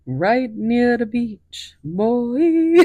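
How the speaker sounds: hint of a Jamaican accent, drawn out. Retelling a movie line in a Jamaican accent. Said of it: "the Jamaican accent".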